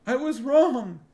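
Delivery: high, loud, sobbing despair. A narrator's reading. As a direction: wail it through tears. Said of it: "Sinedra sobbed".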